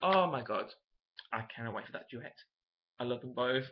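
normal talking voice

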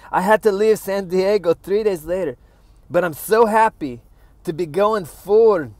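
over-bright fake-happy quote; smug gushing. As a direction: gush it like a self-satisfied star. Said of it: "how smug this sounds".

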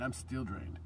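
usual speaking voice

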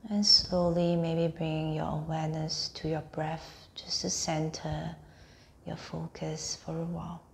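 unhurried meditation voice, phrase by phrase; guided meditation. Acted. Teacher guiding listeners lying on mats through a body scan, guided meditation.

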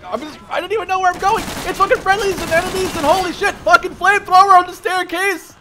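loud, high, fast gleeful rant; high-pitched excited shouting with a big smile; giddy adrenaline. Real, not acted. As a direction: grin through the chaos, almost shouting. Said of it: "I'm sitting here with the biggest smile".

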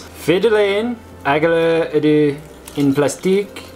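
playful fake French reading; mock French accent. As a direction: read the label in hammy French. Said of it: "That's French".

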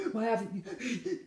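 mock tearful, gasping plea; acted panic. Woman ringing partner who hasn't replied, acted panic. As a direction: drag it out through hyperventilating breaths. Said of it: "And I'll get like that".

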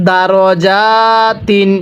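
দারজাতিন with a short দা, not দারা, very slowly; correct recitation. Shown as a demonstration. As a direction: keep the দার in দারজাতিন short. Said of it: "এখানে দারা পড়ব না". (দারা নয়, দারজাতিন ধীরে)

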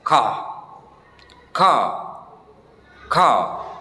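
car with no r at the end, long open a; teacher demo of British car. Shown as a demonstration. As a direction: say car as kah, dropping the r. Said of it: "R-nya disamarkan atau bahkan dihilangkan".

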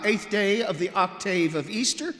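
ordinary talk, nothing marked

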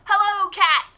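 high, bright greeting as the duck; cheerful duck. Acted. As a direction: call out hello as a friendly duck. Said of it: "said Duck".

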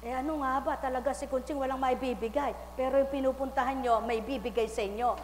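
normal talking voice

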